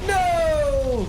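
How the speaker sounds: loud, high cry of No; real shout of dismay. Real, not acted. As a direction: yell it as your friend falls. Teammate dies in game.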